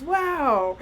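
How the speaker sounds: put-on a nurse impressed by the child; impressed wow. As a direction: sound genuinely impressed. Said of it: "And he goes".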